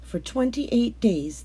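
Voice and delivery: ordinary delivery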